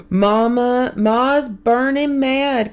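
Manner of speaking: put-on high, slow child voice; a boy rushing in with bad news; Kermit, upset boy. A narrator's reading. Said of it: "Kermit says".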